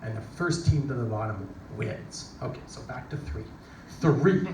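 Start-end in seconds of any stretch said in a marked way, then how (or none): 4.0-4.5 countdown call. Retold or imitated. Countdown at the starting gate.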